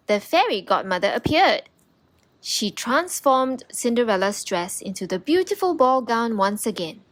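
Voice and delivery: usual speaking voice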